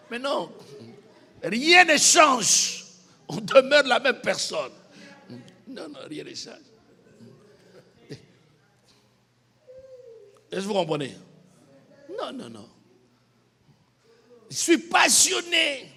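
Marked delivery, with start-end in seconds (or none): 1.4-2.8 "rien ne change" very slow, loud and higher; preacher pounding the point home; emphatic. Real, not acted. Preacher hammers his point. 14.5-15.9 slow, loud and higher boast; mock boast of passion; proud claim. Acts out a proud believer.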